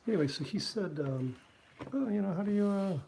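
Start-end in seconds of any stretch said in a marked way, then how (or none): 1.9-3.0 high, put-on voice of an old cartoonist; lightly lampoon how Schulz talked; higher mock voice for Schulz. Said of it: "this is how he talked".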